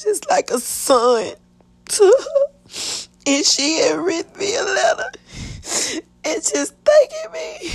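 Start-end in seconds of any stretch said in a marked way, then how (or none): 1.9-7.7 high, cracking crying voice; real grief welling up; tearful. Real, not acted. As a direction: let the tears break in. Crying over mother's letters.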